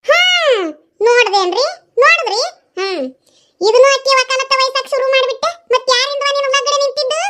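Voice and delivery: angry outburst. Acted. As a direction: flare up and appeal to the husband. Mother erupts after daughter defends sister.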